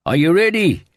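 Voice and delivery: high, loud shouted question; urgent shout to someone right beside you; shout in the ear. A narrator's reading. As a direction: yell it over roaring wind. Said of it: "he heard a voice shout in his ear".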